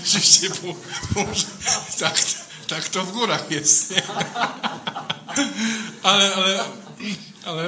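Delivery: laughing loudly through the words. Real, not acted. Priest laughing along with the congregation at his joke. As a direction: chuckle it out, amused at his own joke. Priest jokes about mountain ways.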